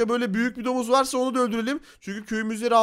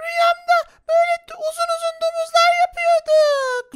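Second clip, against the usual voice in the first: put-on girly high spider girl voice. Spider girl tells dream. (çok ince sesli örümcek kız)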